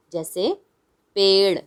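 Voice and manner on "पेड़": flick the tongue lightly on the last sound of "पेड़"; flapped ड़. Shown as a demonstration. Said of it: "तो हम इसको बोलेंगे".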